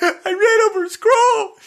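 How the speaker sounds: high, loud, half-laughing mock cry; wife wailing. Imitating his wife wailing over the squirrel, high mock cry. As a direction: whine it like a distraught wife.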